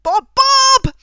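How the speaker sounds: high and loud, building to a shout; excited exclamation. Real, not acted. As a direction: read the names out with mounting delight. Excited to find a Bob.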